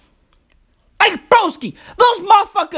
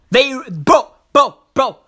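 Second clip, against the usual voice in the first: ordinary delivery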